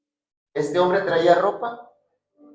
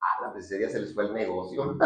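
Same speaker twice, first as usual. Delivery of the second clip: laughing joke; 11 semitones lower, 15 dB quieter, faster than usual. Real, not acted.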